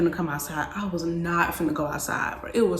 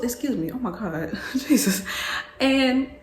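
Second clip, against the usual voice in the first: ordinary talk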